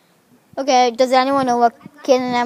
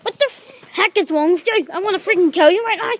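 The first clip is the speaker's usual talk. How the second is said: high, heated, fast-building exasperation; angry rant; 6 semitones higher, 4 dB louder, faster than usual. Real, not acted. Berating someone who annoyed them, angry rant. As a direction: rail at them in a raised pitch. Rows with others over behaviour.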